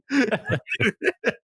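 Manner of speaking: high burst of laughter. Real, not acted. Laugh after the story.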